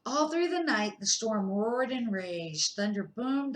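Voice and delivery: plain reading voice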